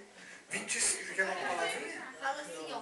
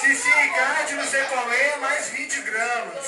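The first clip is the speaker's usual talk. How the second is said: anúncio bem alto; 7 dB louder than usual. Real, not acted. Auctioneer announces bids loudly.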